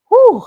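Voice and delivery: high, loud exhale of disbelief; a real burst after getting heated; loud whew. Real, not acted. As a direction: blow out the heat in a loud whew. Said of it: "I was getting heated".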